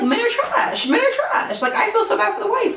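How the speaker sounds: very high-pitched indignation; exasperated rant. Real, not acted. Storyteller reacting to his wife and babies, exasperated. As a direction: squeal it out in disbelief. Ranting about cheating man.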